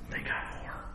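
unvoiced hush for the inner voice; breathy inner nudge, barely there; whispered Holy Spirit voice. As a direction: whisper it like a voice in your head. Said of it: "I get a Holy Spirit voice".

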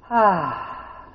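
long breathy sigh of relief; relieved sigh. Acted. Preacher acting out getting away, relieved sigh. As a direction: let it all out in a sigh.

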